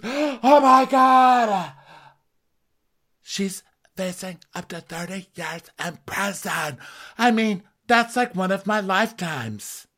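high, hammed-up horror; gasp, then wail the news in shock; mock gasping. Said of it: "like, gasping at her phone".

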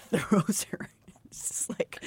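hushed, breathy retelling; whispered. Retold or imitated. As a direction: whisper the quote under your breath. Retelling Rhysand throwing Feyre.

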